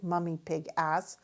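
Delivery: plain reading voice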